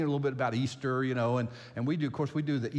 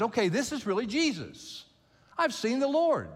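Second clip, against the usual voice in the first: high-pitched, exclaimed discovery; excited realization; 10 semitones higher, 4 dB louder than usual. Acted. Mary recognizing the risen Jesus at the tomb, excited realization. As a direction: burst out with it as the truth dawns. Voicing Mary's moment of recognition.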